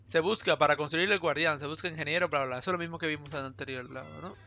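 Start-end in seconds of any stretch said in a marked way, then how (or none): none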